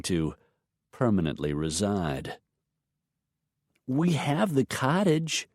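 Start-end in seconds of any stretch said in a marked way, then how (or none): 3.9-5.5 put-on high, slow matter-of-fact child; a kid making her case; little girl voice. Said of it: "Madison pointed out".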